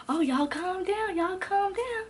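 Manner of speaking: high, drawn-out calming plea; imitated peacemaker. Classmate tells arguing girls to settle, high pleading imitation. Classmate trying to calm the quarrel.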